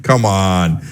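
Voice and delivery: usual speaking voice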